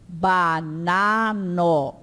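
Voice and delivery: "banano" very slowly, every A open and oral; non-nasal A. Shown as a demonstration. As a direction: draw out "banano" syllable by syllable, no nasalizing. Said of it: "pra não anasalar".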